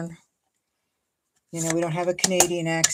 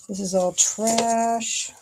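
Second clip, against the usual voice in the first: drawn-out trash; 5 semitones higher, much slower than usual. Real, not acted. Sorting paper scraps.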